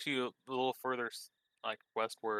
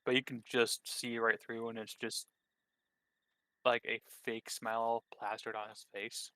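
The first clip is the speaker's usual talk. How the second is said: usual speaking voice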